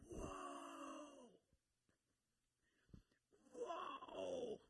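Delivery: high, whispered whoa of wonder; awed whoa. Real, not acted. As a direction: let the awe slip out in a whisper. Reacting to forgiveness line.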